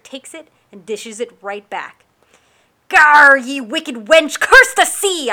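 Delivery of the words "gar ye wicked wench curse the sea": loud, high gruff pirate roar, stretched out slow; pirate shout. Acted. Pirate hanging from the yard cursing the storm, roaring. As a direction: curse the sea like a pirate at full lungs. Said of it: "I shouted and pulled myself up".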